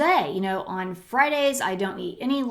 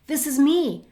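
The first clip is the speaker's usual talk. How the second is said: higher, louder gasp of recognition; excited recognition; 7 semitones higher, 4 dB louder than usual. Retold or imitated. Audience woman recognizing herself in the talk, excited. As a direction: sound like it's just hit you. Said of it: "she said".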